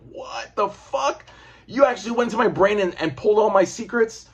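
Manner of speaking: high, stunned disbelief at the betrayal; shocked outrage. Retold or imitated. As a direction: squeal it out like Cap can't believe it. Cap shocked, brain raided.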